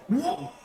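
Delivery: high-pitched roaring cry; acted scream. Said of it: "as you scream and shout out".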